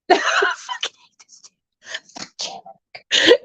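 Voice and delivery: whispered, slowly dragged-out grumble under the breath; muttered aside. Retold or imitated. Retelling her grumble about the doctor, whispered. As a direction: hiss it so the doctor can't hear.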